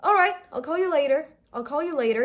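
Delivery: "I'll call you later" said lightly, subtly falling; subtle statement. Shown as a demonstration. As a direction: keep it understated. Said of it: "in a very subtle manner".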